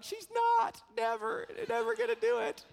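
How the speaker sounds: very high mock kid voices; little girl's friends. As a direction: imitate her friends chiming in, high-pitched. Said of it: "all of her friends were on stage".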